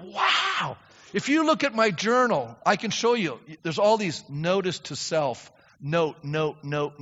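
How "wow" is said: high bright wow of discovery; sudden delighted wow; amazed wow. Retold or imitated. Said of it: "and I'm going".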